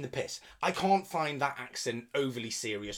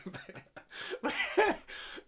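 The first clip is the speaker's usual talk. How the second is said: high laugh; 3 dB quieter than usual. Real, not acted. Laughing at Bezos joke.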